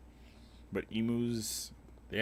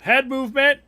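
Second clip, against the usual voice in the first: high, loud yell of hype; excited shout; 16 dB louder than usual. Real, not acted. Hyped shout cheering a fighter's head movement. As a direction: shout it like a ringside fan.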